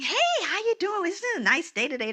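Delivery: high, lilting, chirpy greeting; friendly chatty cashier. Retold or imitated. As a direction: chat up a customer in a high sing-song. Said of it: "They're just chatting up".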